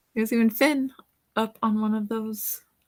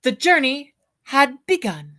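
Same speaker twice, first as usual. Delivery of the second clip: put-on bright, lively read-aloud for children; storybook reading; 3 semitones higher, 6 dB louder than usual. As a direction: read it like a bedtime picture book to kids. Reads picture book aloud.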